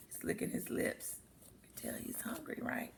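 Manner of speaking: quick whispered aside to the listener. Real, not acted.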